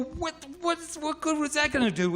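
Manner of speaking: very high, squeaky-pitched disbelief; incredulous. Retold or imitated. Swimmer questions his buddy about the log, high-pitched incredulity. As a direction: fire it back high and baffled.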